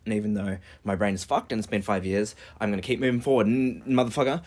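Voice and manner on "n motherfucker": ordinary talk, nothing marked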